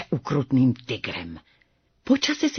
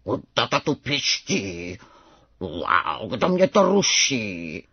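kitten roaring like father; 8 semitones lower, slower than usual. A narrator's reading. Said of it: "zařval divné jako tatínek".

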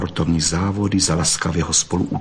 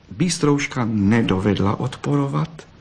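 ordinary delivery, nothing marked

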